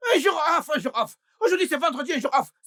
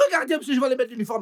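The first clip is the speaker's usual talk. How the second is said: ordinary delivery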